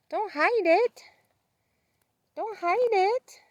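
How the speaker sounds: high, sing-song pet voice with rising ends. Real, not acted. Owner coaxing Sissy to show her tail, high pet talk. Cooing at a shy goat.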